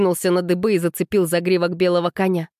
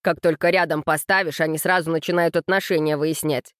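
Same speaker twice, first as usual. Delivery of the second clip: ordinary delivery, nothing marked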